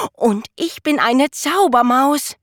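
high, loud proud cheer; jubilant. A narrator's reading. Jonathan proudly calling himself a magic mouse, high and loud. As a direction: crow it with triumph. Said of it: "jubelte Jonathan".

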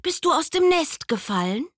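hohe Tierstimme, besorgt; Igel fragt. Acted. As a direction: eine kleine Kinderbuchfigur fragt nach. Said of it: "fragte der Igel". (hohe, neugierige Igelstimme)

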